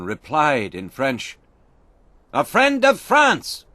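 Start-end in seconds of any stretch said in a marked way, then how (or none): 2.3-3.6 much higher, louder answer; called reply. A narrator's reading. Duncan answering the French sentry, called out high. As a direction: call back to the sentry.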